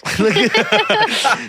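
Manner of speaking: a burst of high, loud laughter; real laugh. Real, not acted. Cracking up at the cheeseburgers punchline, loud laughter. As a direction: crack up at your own joke.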